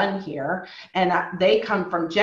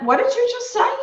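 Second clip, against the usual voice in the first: much higher, stunned disbelief; shocked question; 14 semitones higher, faster than usual. Retold or imitated. Rose at the meeting, reacting to the slur in high disbelief. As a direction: ask it like you can't believe your ears. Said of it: "and I went".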